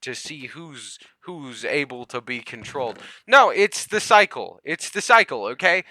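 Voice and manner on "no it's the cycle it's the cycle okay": firm, louder insistence on no; emphatic correction. Real, not acted. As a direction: set them straight, firmly. Insists, correcting conspiracy theories.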